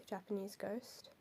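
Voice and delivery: ordinary delivery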